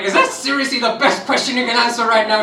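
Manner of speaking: bleeding, can't believe the questions; exasperated laughing protest to the nurse; laughing disbelief. Retold or imitated.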